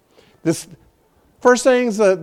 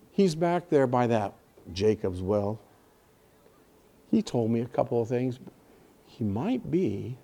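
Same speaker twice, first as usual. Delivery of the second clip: soft, hesitant, unexcited mumble, much quieter; flat, timid telling; 9 semitones lower, 12 dB quieter than usual. Retold or imitated. The woman from the well telling the town, meekly and quietly. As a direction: shrug it off as if it barely mattered. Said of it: "I don't picture her talking like that".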